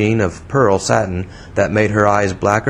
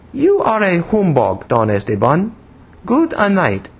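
grave scolding; 10 semitones higher than usual. A narrator's reading. Woman shaking her finger at him, grave scolding. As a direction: chide him in a girlish accent. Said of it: "shook her small finger at him gravely, and said".

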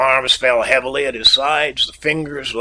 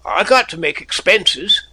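firm, final repeat of got to make expenses, a bit higher; closing the argument; 7 semitones higher than usual. A narrator's reading. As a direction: settle it, no more discussion. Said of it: "he repeated, as though disposing of all argument".

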